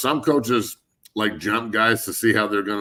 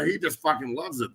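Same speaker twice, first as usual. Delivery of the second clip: ordinary talk, nothing marked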